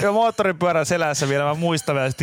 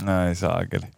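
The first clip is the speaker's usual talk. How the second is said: usual speaking voice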